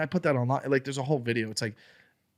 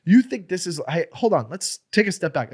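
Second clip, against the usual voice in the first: retold heated argument; 5 semitones higher, 5 dB louder than usual. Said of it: "now we start arguing".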